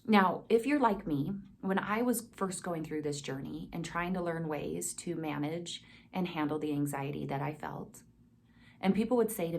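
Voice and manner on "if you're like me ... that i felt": usual speaking voice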